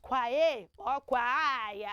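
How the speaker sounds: "kwae or kwai" with a kw start and drawn-out vowels; wrong pronunciations. Shown as a demonstration. Teacher showing children what not to say, wrong pronunciations. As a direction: stretch "kwai" slowly to rhyme with "why". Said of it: "That word is not pronounced".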